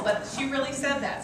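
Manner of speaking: ordinary talk, nothing marked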